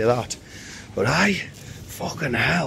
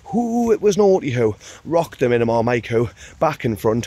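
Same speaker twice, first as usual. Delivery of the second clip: ordinary talk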